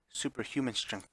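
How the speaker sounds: ordinary delivery, nothing marked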